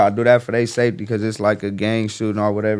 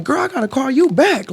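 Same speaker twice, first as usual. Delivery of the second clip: neighbor lady. Retold or imitated. To her friend on the phone, a high mock lady voice. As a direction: play the startled neighbor lady, pitched way up.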